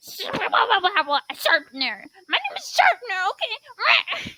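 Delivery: very high, squeaked-up voice. Acted. Sharpener insisting on the name, very high-pitched. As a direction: push the voice way up, insistent. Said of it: "but higher pitched".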